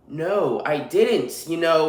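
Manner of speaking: ordinary talk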